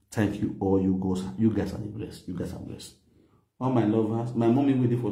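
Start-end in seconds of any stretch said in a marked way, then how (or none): 2.3-2.9 whispered. Shown as a demonstration. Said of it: "repeated blessing".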